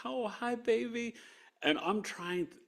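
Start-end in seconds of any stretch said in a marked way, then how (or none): 0.0-1.2 high, sugary greeting; sweet wife voice. Retold or imitated. Wife greeting her husband in hospital, high and sugary.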